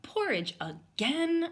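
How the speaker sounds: slow, whiny, high complaint; complaining kid. Acted. Young bear complains about breakfast again, slow high whine. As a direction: drag out the groan about breakfast. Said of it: "I said".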